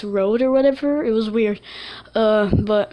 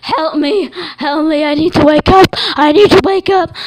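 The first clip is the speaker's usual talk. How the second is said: higher and much louder, frantic; panicked yell; 6 semitones higher, 8 dB louder than usual. Retold or imitated. Dreamer pleading with his mini-me, loud panicked yell. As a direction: shout for help in rising panic. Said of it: "I was just panicking".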